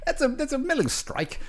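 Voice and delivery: high-pitched put-on British voice; mock British accent. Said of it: "speaking in a British accent".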